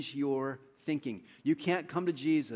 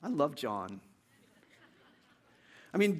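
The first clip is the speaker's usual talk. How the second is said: ordinary talk, nothing marked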